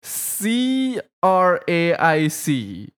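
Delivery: slow letter-by-letter spelling; drawn-out letters, evenly spaced. Shown as a demonstration. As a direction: spell it out deliberately for everybody. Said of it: "Just spell it out to everybody".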